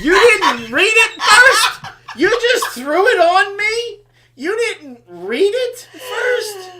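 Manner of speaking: high, loud, slow outraged questions; outraged disbelief. Retold or imitated. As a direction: hammer each word in disbelief. Outraged at wife's mistake.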